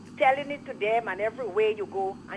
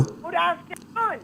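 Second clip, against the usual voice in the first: speaking up; 9 semitones higher, slower than usual. Real, not acted. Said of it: "Speak up, speak up".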